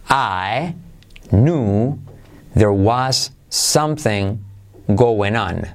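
slow "there was something going on", phrase by phrase; careful learner-paced demonstration; slow model. Shown as a demonstration. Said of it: "¿Conmigo?".

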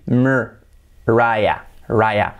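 "Mariah" split as mer, RYE-a; syllable-by-syllable demo. Shown as a demonstration. Teacher breaking down the first name, English pronunciation. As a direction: separate "Mariah" then "Raya" with English R. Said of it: "se pronunciaría así".

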